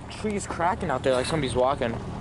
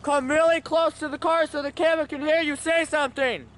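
high raised calling voice; talking to a ghost; 10 semitones higher than usual. Real, not acted. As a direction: coax the spirit to come closer. Addressing spirits at cemetery.

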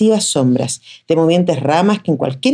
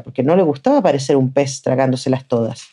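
ordinary delivery

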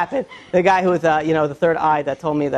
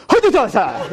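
rapid-fire burst of fake Japanese; mock Japanese; 4 semitones higher than usual. Japanese greeter to a customer walking in, mock Japanese. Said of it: "sounded like he was shooting guns at me".

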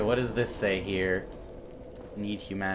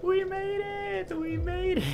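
overjoyed squeal; 5 dB quieter than usual. Real, not acted. Gamer celebrating reaching safety, overjoyed squeal. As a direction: softly squeal it in disbelieving joy.

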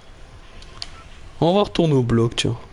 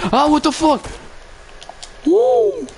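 startled yelp; slower than usual. Real, not acted. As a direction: jump at a sudden scare. Startled by a cat.

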